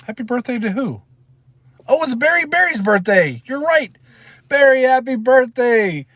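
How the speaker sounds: high, loud, delighted shout-out; excited birthday greeting. Real, not acted. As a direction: cheer the viewer on his birthday.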